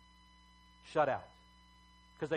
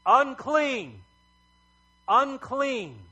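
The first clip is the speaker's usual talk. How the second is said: loud, high cry of a leper; ringing shout, each cry falling at the end; yelled warning; 9 semitones higher, 10 dB louder, much slower than usual. Acted. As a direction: call it out to warn everyone off. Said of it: "You yelled".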